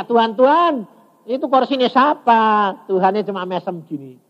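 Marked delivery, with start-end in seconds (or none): 0.0-2.7 high, insistent call; questioning aloud. Retold or imitated. Preacher re-enacts asking God about the chair, calling aloud. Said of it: "saya tanya".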